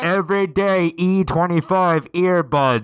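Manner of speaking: upbeat sponsor read; upbeat ad read for earbuds. Acted. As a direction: sell it like a cheerful ad.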